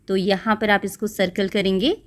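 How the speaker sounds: usual speaking voice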